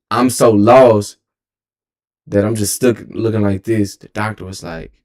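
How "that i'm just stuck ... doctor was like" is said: ordinary delivery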